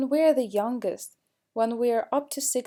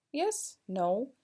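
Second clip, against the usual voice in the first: ordinary talk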